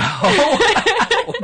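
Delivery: loud high laugh of disbelief; burst of laughter. Real, not acted. Amused disbelief.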